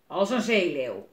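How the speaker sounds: loud growl of a fierce sailor; growling pirate. A narrator's reading. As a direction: growl it gruffly and loudly. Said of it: "gromt woeste Willem". (luid grommende zeerover)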